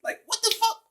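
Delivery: high-pitched mock outrage at himself; incredulous at himself. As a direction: gasp at your own past self, voice flying up. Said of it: "I was like".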